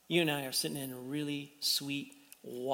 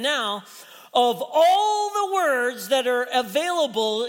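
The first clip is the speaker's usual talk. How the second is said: higher, louder sermon push, slowing on key words; preaching; 7 semitones higher, 7 dB louder than usual. Acted. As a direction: lean into each word from the pulpit. Preaching with emphasis.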